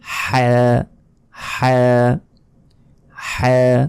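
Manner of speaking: হ্যাঁ as Arabic "ḥaa", strong friction from a squeezed throat; constricted-throat ha, vowel held; from inside throat. Shown as a demonstration. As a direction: squeeze out a long rasped h in হ্যাঁ. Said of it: "গলার ভেতর থেকে উচ্চারণ".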